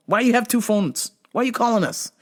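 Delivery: ordinary talk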